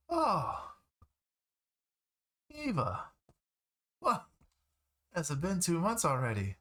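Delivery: high-pitched neighbor greeting a returning friend; surprised high voice, swooping down on each exclamation. Acted. Game master voices neighbor Sorel.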